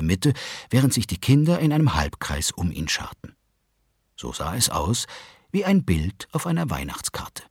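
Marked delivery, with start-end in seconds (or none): none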